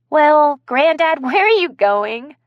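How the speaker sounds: high, loud, laughing call. Acted. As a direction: laugh while calling after her. Kaylee laughing as Blaze jumps up.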